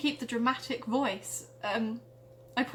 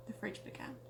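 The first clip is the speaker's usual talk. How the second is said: whisper; 4 semitones lower, 16 dB quieter than usual. Acted. Whispered narration.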